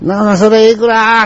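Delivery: loud, high question called from afar. Retold or imitated. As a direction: call out the price question loudly. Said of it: "って大きな声で言うねん".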